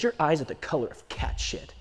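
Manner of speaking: low, soft and cutting; cold quiet cruelty. Retold or imitated. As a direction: let the insult drop low and cold. Father's cruel line repeated quietly.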